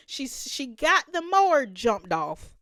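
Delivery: ordinary delivery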